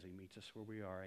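usual speaking voice

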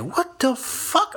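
high, drawn-out incredulous squeal; hammed-up bewildered outrage; stunned disbelief. Stunned after she slams the door.

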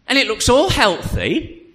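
normal talking voice

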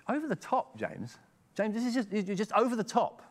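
high-pitched, exasperated objection; mock protest. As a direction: push the voice up in mock disbelief. Acts out listeners' reaction to James.

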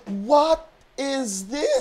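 high, very drawn-out disbelief; incredulous. Retold or imitated. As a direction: stretch each word in shock at the price. Retold shock at taxi price.

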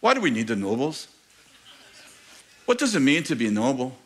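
put-on amateur Scottish accent; William Wallace's Scottish accent. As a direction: put on your best attempt at a Scottish film hero. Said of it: "That's my Scottish accent, the best I can do".

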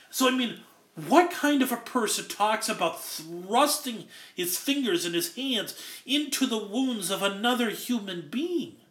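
ordinary talk, nothing marked

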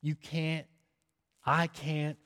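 normal talking voice

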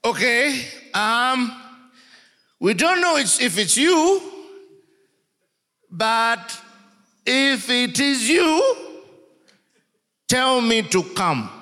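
high eager Peter voice; excited Peter. Retold or imitated. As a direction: blurt it like a nervous fisherman. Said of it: "Peter says".

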